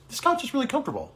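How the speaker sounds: a hint of a kid's voice praising the couch; son's voice. Son sits on couch, dad mimics him lightly. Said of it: "my son sat on it and he goes".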